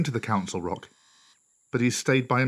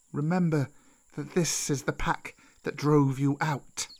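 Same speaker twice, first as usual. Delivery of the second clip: put-on old snake Kaa; 3 semitones higher than usual. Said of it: "Kaa said".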